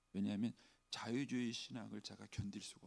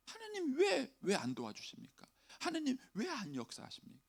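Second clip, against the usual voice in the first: much higher, pleading why; complaining to God; 12 semitones higher than usual. Acted. Voicing people's complaint. (하나님께 원망하듯 높은 목소리로)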